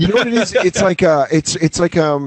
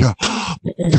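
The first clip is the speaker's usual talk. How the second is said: shocked gasp; 4 semitones higher, 5 dB quieter than usual. Retold or imitated. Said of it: "And you're like".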